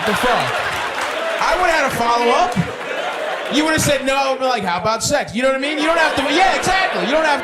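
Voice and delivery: near-shouting in mock outrage. Real, not acted. Host yelling at guest about his missing follow-up, exasperated.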